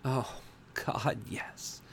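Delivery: panting. A narrator's reading. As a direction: pant the words, ending voiceless. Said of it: "I panted".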